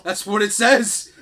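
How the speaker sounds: laughing, slow and loud; laughing defense. Real, not acted. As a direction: insist through laughter.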